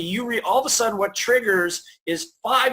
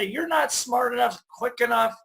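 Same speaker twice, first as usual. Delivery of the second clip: raised voice of self-doubt; nagging inner voice; 6 semitones higher than usual. Acted. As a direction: list failings like a discouraging mother, pitched up. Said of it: "this little voice that goes".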